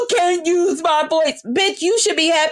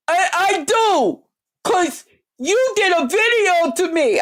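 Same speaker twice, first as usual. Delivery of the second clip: mocking her voice; 4 semitones higher than usual. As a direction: drag it out high, imitating her. Acting out the other woman's reply.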